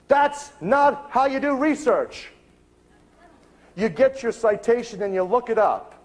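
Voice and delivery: high, slow mock lecture; indignant scolding. Librarian correcting the researcher's method, indignant.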